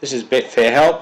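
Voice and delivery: usual speaking voice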